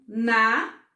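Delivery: "Na" said alone, loud and clear; isolated syllable. Shown as a demonstration. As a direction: punch out "Na" as one counted beat. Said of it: "falar a palavra pra vocês e contar".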